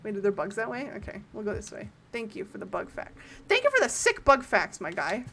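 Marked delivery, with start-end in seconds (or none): 2.1-3.2 soft, low-energy thank-you; understated, quieter acknowledgement; low-key thanks. Real, not acted. Thanks, then repeats enthusiastically. 3.5-5.2 playful, energetic gratitude; hyped-up thanks. Real, not acted. Repeats thanks with enthusiasm.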